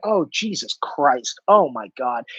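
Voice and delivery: low, slow, shaken sigh; dismayed groan. Retold or imitated. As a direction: let it sink in with horror. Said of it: "And I went".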